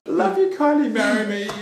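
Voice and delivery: high, loud giddy fan squeal; gushing declaration. Real, not acted. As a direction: gush it out to Kylie, giggling.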